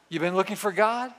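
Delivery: ordinary delivery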